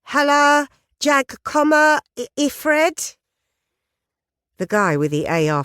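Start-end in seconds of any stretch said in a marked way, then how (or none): 0.0-3.2 put-on Swedish with a clumsy English accent, high and loud; high, loud, deliberately bad Swedish accent; mangled Swedish. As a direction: botch the Swedish on purpose, tourist style. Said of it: "deliberately messing up both the accent and the pronunciation".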